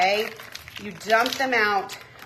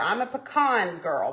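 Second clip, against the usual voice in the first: ordinary talk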